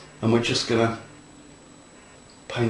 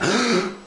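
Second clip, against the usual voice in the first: gasp; 9 semitones higher than usual. Real, not acted. Admiring own detail.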